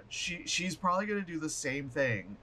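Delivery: ordinary delivery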